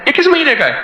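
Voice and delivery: high, eager asking which issue; the asker's excited question, pitched high; surprised question. Retold or imitated. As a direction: ask it as if amazed. Man asks about magazine issue.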